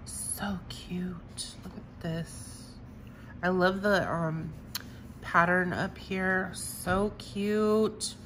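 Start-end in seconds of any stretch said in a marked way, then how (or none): 0.1-2.7 soft breathy whisper of delight; whispered cooing. Real, not acted. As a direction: whisper it like a secret treat. Cooing over tiny jars.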